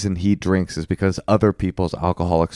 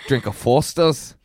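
put-on Australian accent; 2 semitones higher than usual. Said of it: "that Australian lilt".

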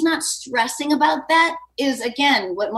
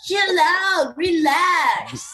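breezy mock French accent, pitched a bit higher; French guy impression; 5 semitones higher, slower than usual. Cohost mocking the relaxed French boyfriend, breezy French accent. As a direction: brush her off like a careless French guy. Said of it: "That's a good impression".

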